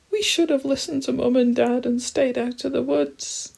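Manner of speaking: very high, loud little-animal wail; frightened wail. Acted. Trembling animals regretting the trip, wailing high. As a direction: lament in a scared squeal.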